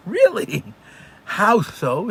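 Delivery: surprised. Retold or imitated. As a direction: caught off guard, asking eagerly. Shliach hears surprising family news.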